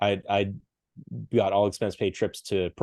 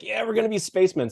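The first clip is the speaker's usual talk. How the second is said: excited; 12 semitones higher than usual. Retold or imitated. Buddies joke about becoming astronauts.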